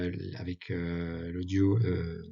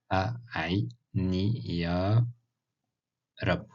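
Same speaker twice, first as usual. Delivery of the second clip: slow repetition; much slower than usual. Shown as a demonstration. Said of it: "repeats phrase slowly".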